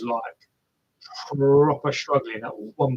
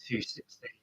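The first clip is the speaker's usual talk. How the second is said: whispered; 2 semitones lower, 15 dB quieter than usual. Real, not acted. Amazed at a fast split.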